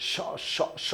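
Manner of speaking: স as a long, whistling hiss. Shown as a demonstration. Teacher demonstrating the hissing sound of শীষ ধ্বনি. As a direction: hiss the স out like air escaping. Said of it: "শীষের মতো আওয়াজ হয়".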